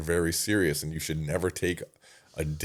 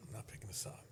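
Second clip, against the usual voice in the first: whispered to himself; 4 semitones higher, 21 dB quieter than usual. Retold or imitated. Dodging a friend's call, repeated.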